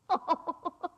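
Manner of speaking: a short unhinged cackle; mad laugh. Acted. Killer gloating to Holmes, mad cackle.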